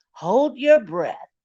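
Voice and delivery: loud, slow robotic order; loud slow mechanical command; machine command. Retold or imitated. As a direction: bark it like a scanner. Voicing the machine.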